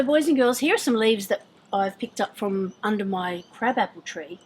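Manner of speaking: normal talking voice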